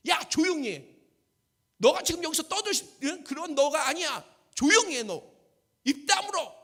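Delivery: loud, impatient shushing; acted crowd hushing a nuisance; scolding rebuke. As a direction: tell someone off in quick bursts. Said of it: "사람들이요, 면박을 주고요, 저지하고요".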